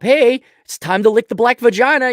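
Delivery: a hammed-up boss calling you in; mock boss summons. Boss calls worker to comply.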